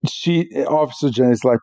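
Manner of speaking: usual speaking voice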